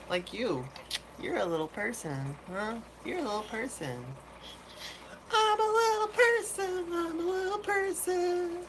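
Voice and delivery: put-on high cooing baby talk to the fish; squeaky voice talking to a tiny fish. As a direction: coo at the little fish like a baby.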